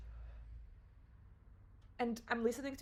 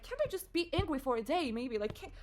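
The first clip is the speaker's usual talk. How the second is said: exasperated, higher-pitched protest; angry at herself; 5 semitones higher, 5 dB louder than usual. Retold or imitated. Arguing for her right to anger, exasperated. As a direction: protest the unfairness, voice jumping up. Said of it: "I was so angry at myself".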